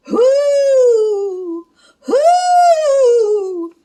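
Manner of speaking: high, loud spooky whoo; ghost wail. Acted. Ghosts moaning outside.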